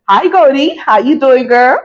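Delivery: perky, teasing welcome; playful greeting. Pretend. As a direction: greet a girlfriend with playful excitement. Playful greeting to returning subscriber.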